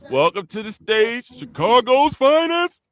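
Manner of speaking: big hype introduction; stage announcer. Acted. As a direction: sell the act like a showman. Host introduces a performer.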